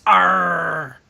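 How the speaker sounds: pirate arr; pirate growl. Pretend. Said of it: "We are talking about pirates".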